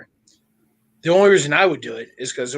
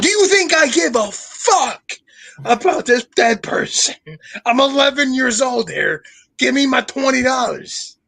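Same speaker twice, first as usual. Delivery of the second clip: higher-pitched, acted kid's outrage; mock-angry, raised-pitch protest; indignant demand; 6 semitones higher than usual. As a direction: demand your money like an indignant eleven-year-old. Acted eleven-year-old demanding his pay.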